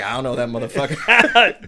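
loud laughing mock denial. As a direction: deny him while cracking up. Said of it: "He's like".

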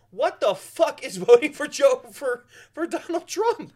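high, slow incredulous yell; disbelieving, drawn-out outrage; exasperated outrage. Real, not acted. Outraged rhetorical question.

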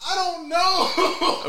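very high, slow, laughing through the words; stifled giggles. Real, not acted. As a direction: barely get the words out for laughing.

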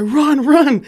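high, loud warning cry; urgent retold shout. Friend warns painter to flee cops, urgent retold shout.

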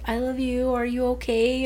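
lightly voiced daughter. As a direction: gently check on mom, as a kid would. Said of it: "she's like".

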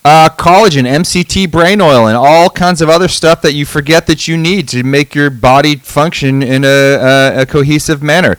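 loud, booming ad read announcer. Acted. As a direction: belt it like a hype announcer. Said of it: "I go into my announcer voice".

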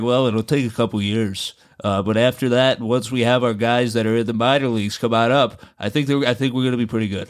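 light impression of a team manager; Aaron Boone. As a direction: put on the manager's even, held-steady voice. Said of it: "[name] always does that fucking voice".

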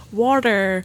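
put-on struggling American accent on one word; badly attempted American "water". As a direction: try hard at an American R. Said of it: "had to play an American".

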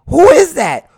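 high, loud shocked question; shocked crowd. Retold or imitated. Crowd seeing the body in the casket, shocked shout. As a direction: hit "is" high and loud in disbelief. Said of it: "everybody is like".

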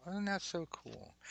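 high, slow, starry-eyed question; mock wide-eyed wonder; awestruck American. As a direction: marvel like a dazzled tourist. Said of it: "They just kinda stare like".

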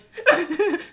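high short laugh imitating him; mimicking the ex's sudden laugh; abrupt mock laugh. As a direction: snap from crying to laughing. Ex abruptly stops crying.

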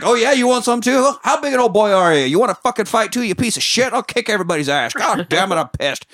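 dad daring the teacher's husband to fight, hammed-up angry; mock furious threats. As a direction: pick a fight down the phone, over the top. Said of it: "He's like".

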